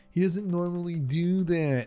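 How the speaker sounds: high, slow whiny excuse voice; mocking dog owner. Parent mocking the dog owner who hurt his baby, whiny high voice. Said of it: "the first thing this person says is".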